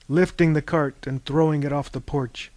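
ordinary delivery

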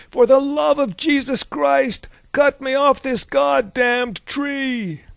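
high, loud, drawn-out holler; desperate yell. A narrator's reading. Captain tied to a cross calls to the crowd, yelling. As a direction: bellow the plea slowly, pitched high. Bound captain demands to be freed.

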